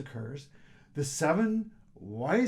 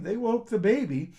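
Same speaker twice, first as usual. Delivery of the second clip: ordinary delivery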